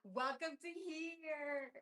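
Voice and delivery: very slow, stretched welcome; lingering host welcome; drawn-out welcome. Real, not acted. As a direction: savor each word.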